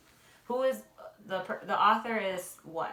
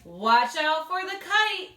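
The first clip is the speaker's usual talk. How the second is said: warning call; 8 semitones higher, 8 dB louder, slower than usual. Acted. Sam warns in story.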